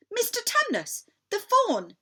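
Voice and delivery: a hint of an indignant little girl; Lucy. A narrator's reading. Lucy naming her friend to Edmund, lightly voiced.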